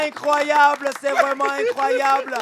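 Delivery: high-pitched disbelief; amazed excitement. Acted. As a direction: marvel at it, still carried away. Host still amazed by the answer. (émerveillement surexcité, voix aiguë)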